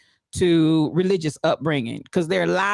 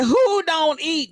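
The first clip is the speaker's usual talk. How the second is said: slow, high, disbelieving question; incredulous quote; 9 semitones higher, much slower than usual. Retold or imitated. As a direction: drag it out in mock disbelief. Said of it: "First Lady said".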